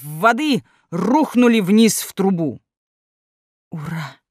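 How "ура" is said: soft, hushed cheer; quiet. A narrator's reading. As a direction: breathe the cheer softly. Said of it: "тихо произнесла Элина".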